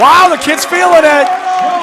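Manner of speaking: high, loud, drawn-out cheer; thrilled exclamation. Real, not acted. Co-commentator raving about the kid's hot hand, thrilled. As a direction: marvel loudly and stretch it out.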